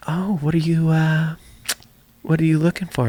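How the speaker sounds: lightly voiced mother-in-law; imitated mother-in-law. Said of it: "my mother-in-law was like".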